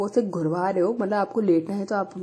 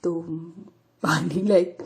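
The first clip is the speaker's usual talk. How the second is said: ordinary talk, nothing marked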